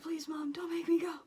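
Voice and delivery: high, loud imitated pleading; retold freak-out. Mom imitating her son begging, mock panic. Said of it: "he started freaking out".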